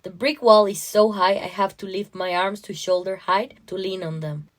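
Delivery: plain reading voice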